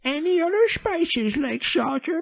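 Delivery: high, slow, hammed-up old lady. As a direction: read it like a sweet granny. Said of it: "that's my old lady voice".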